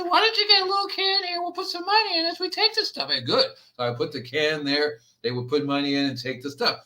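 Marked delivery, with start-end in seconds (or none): 0.0-3.1 much higher put-on voice of a sweet old lady. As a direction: play the neighbourhood lady asking for a money can, high and thin. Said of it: "The lady said".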